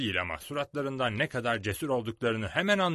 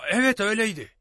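higher, louder eager agreement; a bit excited; 11 semitones higher, 9 dB louder than usual. A narrator's reading. Silver agreeing with the boy about the blind man, excited. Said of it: "dedi Silver biraz heyecanlanarak".

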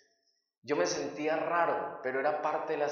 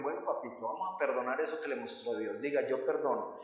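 low, soft coaxing voice; 4 semitones lower, 8 dB quieter than usual. Retold or imitated. Women coaxing him at the prison, soft and gentle. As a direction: gently urge him to forgive.

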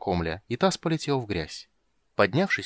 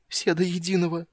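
whimpering; 8 semitones higher than usual. Acted. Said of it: "прохныкал он".

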